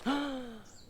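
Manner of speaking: sharp high gasp, imitating her; mock a scandalized gasp; quick intake of shock.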